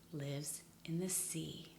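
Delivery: slow secret whisper. Acted. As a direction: whisper the last clue. Gives final clue.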